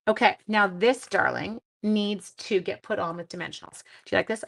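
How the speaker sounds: ordinary delivery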